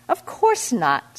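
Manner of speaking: high, slow and haughty; sniffy. A narrator's reading. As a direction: sniff at the very idea. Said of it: "sniffed the Deva".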